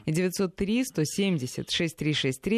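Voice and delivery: usual speaking voice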